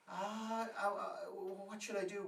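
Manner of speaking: high, loud, stammering panic; mock panic. Woman begging her friend for advice, panicked stammer. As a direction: stammer in alarm, pitched right up.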